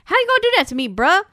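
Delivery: high-pitched, aggrieved imitation; mock indignant protest. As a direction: protest like a wronged buddy, voice jumping up. Retold protest at being double-crossed.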